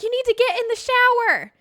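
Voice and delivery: high, loud urgent order; frantic mom. Retold or imitated. Mom to bleeding kid, frantic. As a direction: imitate mom shrieking an instruction.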